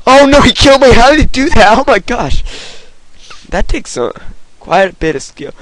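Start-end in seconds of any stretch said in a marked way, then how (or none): 0.1-2.4 high, loud outcry; worked-up dismay. Pretend. Gamer reacts to being killed, high loud dismay.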